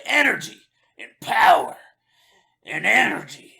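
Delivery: hammed-up, hoarse-edged shout for an energy drink; mock commercial scream. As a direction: belt it out like an absurd advert. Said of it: "then I start screaming".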